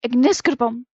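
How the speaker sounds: loud, forceful incantation; spell-casting cry. A narrator's reading. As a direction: fire off the spell. Spell cast in the story.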